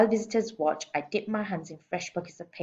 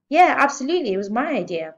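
boastful; 7 semitones higher, 7 dB louder, faster than usual. A narrator's reading. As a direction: claim the credit. Manager claiming credit.

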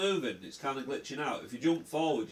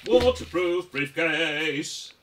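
drawn-out silly voice; 5 semitones higher, 10 dB louder, much slower than usual. Acted. Silly slow repeated joke.

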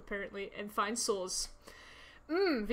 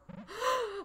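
startled gasp; 11 semitones higher, 4 dB quieter than usual. Real, not acted. Delayed startled reaction.

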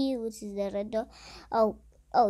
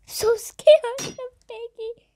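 scared squeal; 10 semitones higher, 5 dB louder than usual. Real, not acted.

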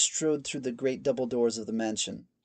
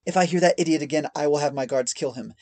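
louder, higher, fast sharp command; Mother Kaltrana turning sharp and fast; sharp threat; 3 semitones higher, 6 dB louder, faster than usual. A narrator's reading. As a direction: snap the threat out. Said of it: "her voice ... now became sharp".

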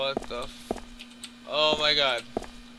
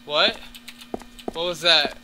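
sudden louder startled question; 4 semitones higher, 7 dB louder than usual. Real, not acted. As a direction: jump at the noise. Hears a noise in game.

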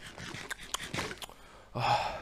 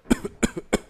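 sick cough; 7 dB louder than usual. Acted. Sick brother after shouting.

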